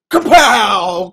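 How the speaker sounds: loud, sudden burst; explosive punch. Acted. Storyteller voicing the punch, explosive shout. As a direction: hit it like a blow landing.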